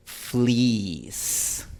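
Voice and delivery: fleece with a long ee ending in a y glide; English fleece. Shown as a demonstration. Teacher modelling English fleece for Spanish learners, clear. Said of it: "en la palabra".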